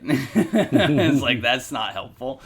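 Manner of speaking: laughing out loud through the words; loud amused laugh, scoffing. Real, not acted. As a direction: burst into a real laugh as you say it. Laughing at time-out idea.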